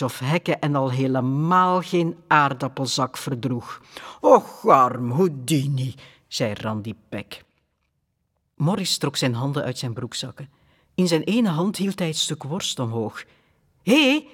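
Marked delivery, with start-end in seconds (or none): 4.2-5.9 slow, louder mock sorrow over the dog; drawn-out fake pity. A narrator's reading. Randy Peck mocks the trapped dog with fake pity. Mocking sympathy for caught dog. 13.8-14.3 high, loud call to the dog. A narrator's reading. Morris calls out to Houdini, high and loud. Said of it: "zei hij tegen Houdini".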